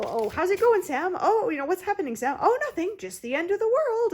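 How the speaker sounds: loud, high, bouncy sitcom delivery; sitcom character. Retold or imitated. Spoofing sitcom characters for her audience, loud and high. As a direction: ham it up like a canned-laughter sitcom. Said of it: "it was like a sitcom".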